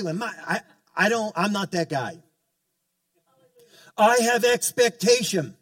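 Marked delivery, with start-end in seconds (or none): 4.0-5.5 louder and higher, full conviction; emphatic. Real, not acted. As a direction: proclaim it with force. Pastor stresses his expectation.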